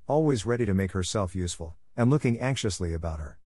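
ordinary delivery

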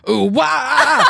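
loud high crow caw. Retold or imitated. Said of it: "She'd go".

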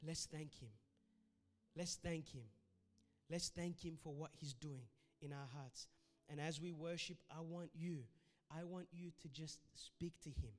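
hushed worship prayer; soft prayer. Acted. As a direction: pray it gently under the music.

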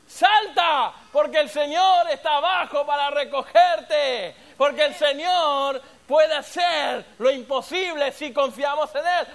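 slow, loud exhortation; preaching. Acted. Preacher urging the congregation to leap in faith, loud and slow. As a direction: urge them to jump, building up.

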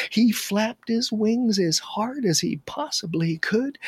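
plain reading voice

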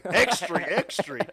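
high, loud paperboy cry; newsboy call. Pretend. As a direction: shout it like a street newsboy. Newsboy calling out.

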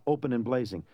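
ordinary delivery, nothing marked

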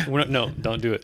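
ordinary delivery

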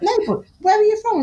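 high, quick imitation of a frustrated man; insistent questioner. Customer pushing a shop worker on her origins, high insistent imitation. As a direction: press the question, pitched right up. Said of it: "I can see him getting frustrated".

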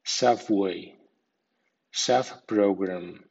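SUB-way, SUB-program; first syllable strong in subway, subprogram; strong stress on prefix. Shown as a demonstration. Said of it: "sub con acento fuerte".

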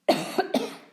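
short hard cough. Real, not acted. Reader interrupts the story, coughing. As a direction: cough to clear the throat. Reader clears throat, apologises.

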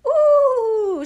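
loud, high sustained howl; horrible howl. A narrator's reading. Witch shut in the burning oven, horrible howl. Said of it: "began to howl quite horribly".

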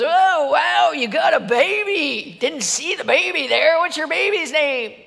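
put-on loud, slow, slurring drunk; hammed-up loaded stranger; a passerby, drunk, marvels at the baby; drunk man's voice. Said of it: "he's, like, totally loaded".

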